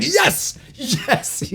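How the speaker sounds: high-pitched, gleeful yes; mock cheering. Comic recalling being the only one cheering, gleefully high. Acting his lone cheer for friend.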